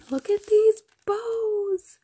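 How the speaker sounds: high, slow gushing coo; cutesy gush. Real, not acted. Showing the blingy bows on camera, high slow coo. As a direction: swoon over the bows.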